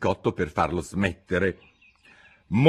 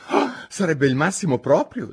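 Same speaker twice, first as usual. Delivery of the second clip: pensive Alice; 9 semitones higher, 4 dB louder than usual. Acted. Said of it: "disse Alice pensierosa".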